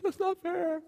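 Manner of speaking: put-on high whiny child complaint; mock child protest; whiny kid. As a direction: mimic a kid whining it's not fair. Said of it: "One of my favorite lines to my kids".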